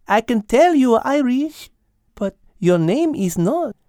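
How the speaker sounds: put-on high French-accented cat, understanding; Mademoiselle. Cat asking Al about his name, high French-accented voice.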